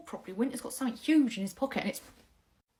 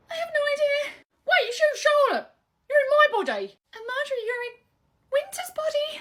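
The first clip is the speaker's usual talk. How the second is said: high, loud panic; freaked-out, raised pitch; panicked; 12 semitones higher, 6 dB louder than usual. Acted. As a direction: blurt it out high in alarm. Panic at swapping bodies.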